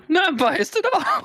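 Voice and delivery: high, giggly squeak; slow, very high, laughing mock innocence; laughing denial. Real, not acted. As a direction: draw it out through giggles. Joking denial of bias, laughing.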